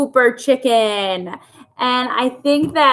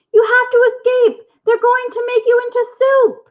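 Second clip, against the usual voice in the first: frantic cries; 12 semitones higher than usual. Acted. Henrietta running after the truck warning her aunts, frantic high cries. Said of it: "drowned out her cries".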